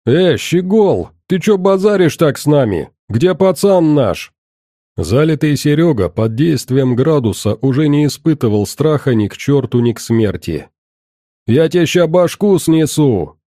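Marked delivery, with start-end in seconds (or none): 0.1-4.3 put-on drunk aggression. 11.5-13.4 put-on high angry drunken threat.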